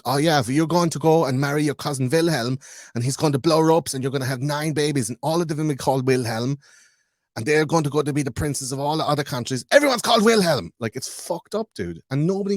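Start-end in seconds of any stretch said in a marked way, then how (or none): 0.0-10.7 higher put-on breezy aristocrat, peaking loud at the end; mock airy royal. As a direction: chirp it like a careless inbred noble. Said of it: "because they're just like".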